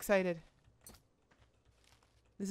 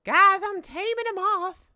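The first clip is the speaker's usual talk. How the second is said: excited squeal; 6 semitones higher, 8 dB louder than usual. Real, not acted. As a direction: announce it in a rising, thrilled cry. Thrilled while taming a moth.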